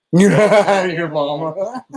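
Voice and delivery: helpless laughter stretching the words; laughing. Real, not acted. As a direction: say it through a big cackle. Laughing at a joke.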